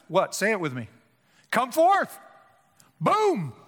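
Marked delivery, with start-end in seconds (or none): 1.5-2.2 commanding shout of come forth; loud call. Acted. Preacher calling Lazarus out of the tomb, loud command. 3.0-3.5 boom. Acted. Preacher emphasizing God's voice.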